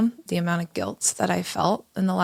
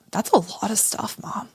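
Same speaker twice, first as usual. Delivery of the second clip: put-on kid's whisper; 3 semitones higher than usual. Child's reply after mom's rant.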